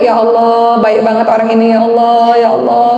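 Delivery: tender self-hug prayer. Shown as a demonstration. Preacher hugging herself, soothing her own heart, tender sing-song. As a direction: hum it gently, hugging yourself. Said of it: "peluk diri sendiri".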